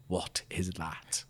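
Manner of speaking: slow, hushed mock disbelief; imitated stunned reaction. Host imitating faces watching him come out, stunned and hushed. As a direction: drop very quiet and draw it out, as a stunned onlooker.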